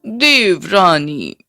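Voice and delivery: slow, drawn-out taunt; teasing, lingering false endearment; mocking. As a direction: stretch the word out mockingly. Sister-in-law taunts Latika.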